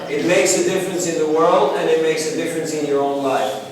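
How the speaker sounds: usual speaking voice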